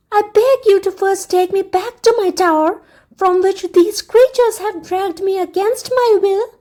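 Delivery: a sweet, dutiful daughter addressing her father; princess voice. A narrator's reading. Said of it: "she said".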